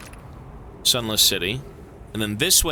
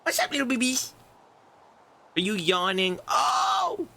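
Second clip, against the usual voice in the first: high sing-song pet talk; 11 semitones higher than usual. Real, not acted. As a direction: coo at the little pet. Cooing at pet Mochi.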